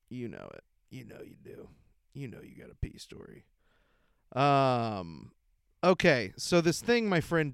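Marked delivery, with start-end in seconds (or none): 0.1-3.4 low, soft whisper, fast at the end; teasing whisper. Shown as a demonstration. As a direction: lean in and whisper the tease. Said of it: "trailing teasing".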